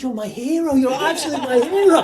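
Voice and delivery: high, loud, laughing gush; fanboy blurting to his idol; loud, high, laughing "my hero". Retold or imitated. Meeting his idol, gushing.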